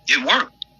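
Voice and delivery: high-pitched mock surprise; imitated amazement. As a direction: echo an amazed follower in a high voice. Repeats followers' amazed reaction.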